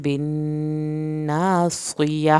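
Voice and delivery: "بالناصیہ" very slow, ending on a breathy h; audible final h. Shown as a demonstration. Teacher to students: very slow, final h. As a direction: sound the h at the end of "بالناصیہ". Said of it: "آخر میں ہا کی آواز نکلے گی".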